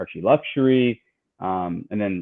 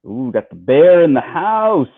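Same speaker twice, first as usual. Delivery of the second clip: excited greeting; 6 semitones higher, 7 dB louder, slower than usual. Real, not acted. Greeting a returning guest on stream.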